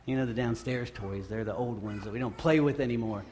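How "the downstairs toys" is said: usual speaking voice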